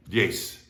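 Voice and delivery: "diez" as dyes with hissed s, dyess; Latin American S. Shown as a demonstration. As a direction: end "diez" on S as in sister. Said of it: "just like the S in sister".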